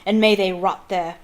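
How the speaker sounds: loud furious roar; loud enraged command. Acted. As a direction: roar the order at the guards. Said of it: "Crouch roared".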